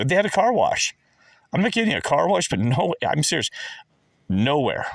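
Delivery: high, loud, worked-up disbelief; incredulous rant. Real, not acted. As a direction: pitch it up like you can't believe it. Ranting about car wash.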